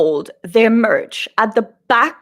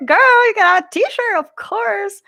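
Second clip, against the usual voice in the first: imitating the sweet seller, much higher; gushing friendly seller; 11 semitones higher, 3 dB louder than usual. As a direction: gush compliments like an excited fan. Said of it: "And he's like".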